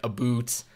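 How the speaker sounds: "boot" with a long "oo", for "about"; drawn-out "a boot" in place of "about"; aboot pronunciation. Retold or imitated. Describing maritime speakers, about pronounced "a boot". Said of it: "I know they say, like".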